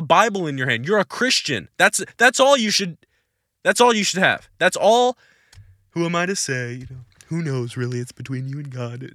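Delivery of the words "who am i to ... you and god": soft, mocking, dismissive mimicry of a pastor; mocking evasive pastor. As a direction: mimic a dodging pastor with light disdain. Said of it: "pastors who want to be P C".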